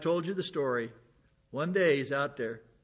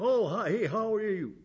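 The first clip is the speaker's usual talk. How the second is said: breezy, higher hello, like a friend dropping by; mock casual greeting; 6 semitones higher than usual. Preacher mocks a casual God greeting the congregation might expect. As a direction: toss off a chummy hi, clearly the wrong version.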